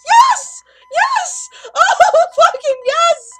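yelling yes at the top of his lungs, laughing; real relief exploding into shouts and laughter; triumphant screaming. Real, not acted. Surviving the night at last.

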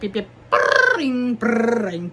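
imitated phone ring sound; brrring it out like a ringing phone. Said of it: "the Yip Yips from Sesame Street".

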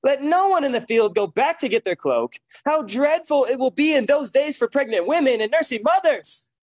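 rising preaching intensity. Real, not acted. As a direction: build the reading into preaching. Preacher reading builds in force.

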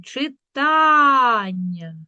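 "Читання" with a very long, slow А; stress on А. Shown as a demonstration. Teacher showing why the check word fails, stressed А. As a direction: stretch the middle syllable of "Читання". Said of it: "наголос падає на".

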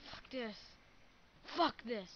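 normal talking voice

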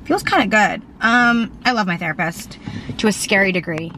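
ordinary talk

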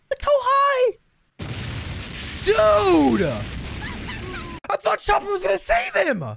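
high-pitched, dismayed disbelief; shocked exclaiming. Real, not acted. Viewer who expected Chopper to save him, dismayed high cry.

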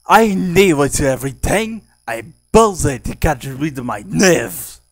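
loud, high, forceful character voice; Kitty Old. Shown as a demonstration. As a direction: push the voice hard, almost shouting. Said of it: "So he sound like this".

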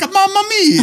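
hammed-up, high-pitched Italian accent; mock Italian. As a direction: belt out a cartoon Italian catchphrase. Acting Italian to get the gig.

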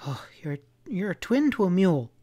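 high-pitched woman's groaned complaint; exasperated groan. A narrator's reading. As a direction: groan at his stubbornness. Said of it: "she groaned".

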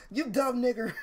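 usual speaking voice

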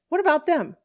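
high, loud, urgent question; alarmed appeal. Acted. Woman calling to departing police about girls, alarmed appeal. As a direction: call after them in alarm.

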